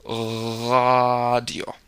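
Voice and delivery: "Radio" with the R rasped in the throat; fricative R. Shown as a demonstration. German teacher showing a learner how to say "Radio" with a fricative German R. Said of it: "push your tongue up more and let it bounce".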